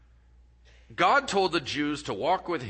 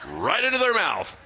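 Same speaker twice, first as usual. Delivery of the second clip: usual speaking voice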